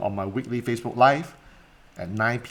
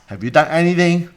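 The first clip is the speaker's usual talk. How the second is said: pointed question; 6 semitones higher, 6 dB louder than usual. Retold or imitated. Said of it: "I ask".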